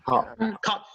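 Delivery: "Cut" with the final T cut off; "Cut" stopped dead before the T sounds; American cut-off T. Shown as a demonstration. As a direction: say "Cut" American style, T unreleased. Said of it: "la T se corta al final".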